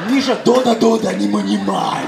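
put-on a touch of Russian accent, firm; firm Russian mother calling her boy; Russian mom. Russian mother at the playground calling her son, firm with a touch of accent. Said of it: "אמא רוסיה".

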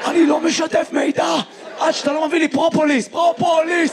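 high, strained mock refusal; defiant hoarse shout. Hoarse man demanding propolis before talking, high strained shout.

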